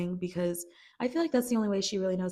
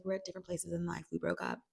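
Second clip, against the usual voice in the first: softer, fast shrug-off; quick soft brush-off; 7 dB quieter, faster than usual. Retold or imitated. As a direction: wave it away quietly. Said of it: "she's like".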